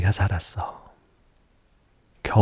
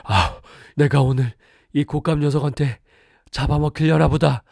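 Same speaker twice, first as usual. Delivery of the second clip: high whimpering tiger fear; frightened tiger; 6 semitones higher than usual. Acted. Tiger sure it will be eaten, high frightened voice. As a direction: fret like a doomed scared tiger.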